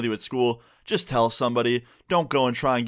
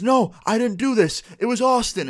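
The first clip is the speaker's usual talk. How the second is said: protesting innocence; 10 semitones higher, 4 dB louder than usual. Retold or imitated. Accused classmate blames student to teacher, imitated indignant protest. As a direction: imitate an accused kid blaming someone else.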